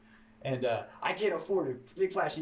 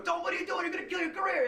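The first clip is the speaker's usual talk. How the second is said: high, alarmed warning voice; mock-panicked friends pleading; squeaky alarmed mock warning. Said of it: "and they go".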